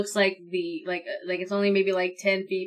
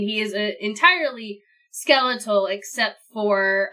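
ordinary talk

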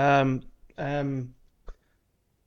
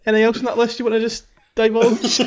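laughing; 9 semitones higher, 6 dB louder, faster than usual. Real, not acted. Teasing through laughter.

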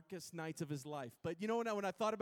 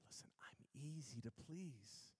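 slow, near-whispered reassurance; Jesus, gentle; 4 semitones lower, 10 dB quieter, slower than usual. Acted. Jesus tells believers he is easy to please, hushed. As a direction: drop right down, soft and unhurried.

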